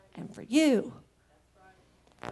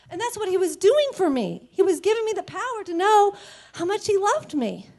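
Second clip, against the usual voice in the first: ordinary talk, nothing marked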